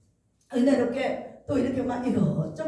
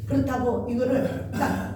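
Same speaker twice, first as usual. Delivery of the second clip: ordinary delivery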